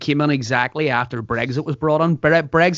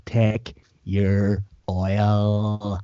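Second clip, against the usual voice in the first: Derry saying; 4 semitones lower, 4 dB quieter, much slower than usual. Shown as a demonstration. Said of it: "As we say in Derry".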